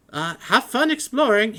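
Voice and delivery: high, loud, flustered scholar voice; excited scholar. Acted. Matrius leaves.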